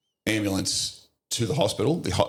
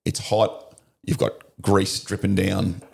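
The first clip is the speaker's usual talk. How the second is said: usual speaking voice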